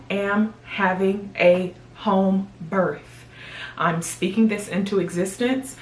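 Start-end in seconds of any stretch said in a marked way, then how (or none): none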